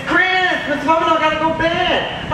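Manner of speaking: put-on little boy asking the teacher. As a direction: pipe it up like a small boy. Child voiced in story.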